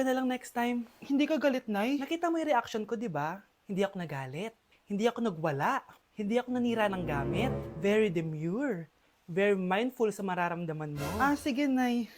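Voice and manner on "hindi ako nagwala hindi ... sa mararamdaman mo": mock demure. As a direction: say it primly, like the demure trend. Quotes the demure meme.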